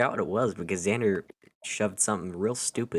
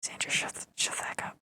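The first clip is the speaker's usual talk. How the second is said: giggly prank whisper; 8 dB quieter than usual. Real, not acted. Hushing Xander while others read.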